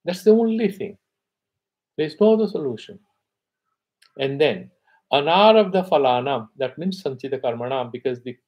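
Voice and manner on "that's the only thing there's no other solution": higher and louder, hammering the point home; emphatic insistence. Real, not acted. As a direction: press it hard, no room for doubt. Insisting on self-knowledge as answer.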